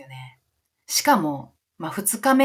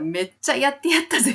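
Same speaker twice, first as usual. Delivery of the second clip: higher-pitched imitated gloating; triumphant boast; 7 semitones higher than usual. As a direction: voice a bandmate proud of the stunt. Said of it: "その離れた瞬間の顔見たら".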